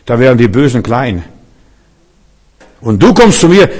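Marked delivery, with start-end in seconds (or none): 2.8-3.7 höhnender Goliath. Retold or imitated. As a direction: taunt with a higher, louder start. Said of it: "Das ist die Reaktion von Goliath". (lauter höhnischer Goliath)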